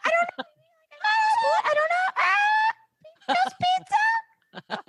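very high, slow squeal; squeaky rising lie. Pretend. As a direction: let the voice fly up into a squeak. Mock guilty squeak.

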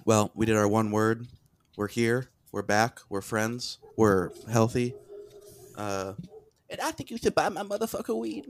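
low Mike Tyson voice. Acted.

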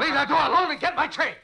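desperate yell to keep the door shut; angry yell. Acted. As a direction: shout him down in panic. Panicked driver yells at attendant.

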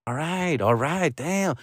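softly muttered, grudging retreat; backing down. Retold or imitated. Dad yielding to the shouting mom, quiet grumbled surrender. Gives in to her tirade.

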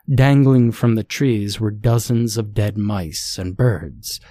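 usual speaking voice

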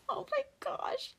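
breathy giggle through the words; laughing whisper. Real, not acted. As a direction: laugh it off under your breath. Laughing at her own stumble.

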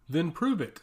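plain reading voice

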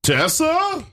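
high alarmed call. Acted. Missiles incoming alarm.

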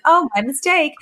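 high, sing-song innocence; playful mock oops; coy fake apology. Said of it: "she's like".

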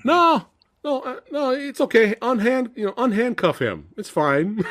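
high, breezy mock counselor voice; mock naive counselor. Mocking the clueless counselor, high and carefree. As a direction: brush it off in a high silly voice.

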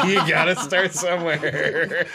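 high, loud words through laughter; laughing. Real, not acted. Friend joking about a first shady gig, laughing hard. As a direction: crack up while saying it.

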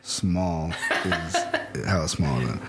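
slow, low, halting read-out; dictating while typing. Real, not acted. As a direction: say each word as you type it, amused. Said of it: "tell me what you're typing as you do it".

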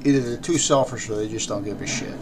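normal talking voice

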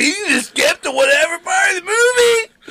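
high, loud burst of wonder; amazed mock exclamation. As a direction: exclaim it as if newly astonished. Acted excited reaction to first DVD.

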